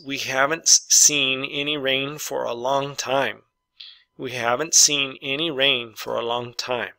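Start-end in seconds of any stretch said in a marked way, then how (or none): 0.1-3.4 slow dictation reading. Shown as a demonstration. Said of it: "Number six".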